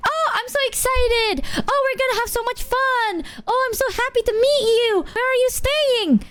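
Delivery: very high, put-on squeal of delight; mock gushing excitement. Imitating a friend thrilled about the visit, exaggerated high excitement.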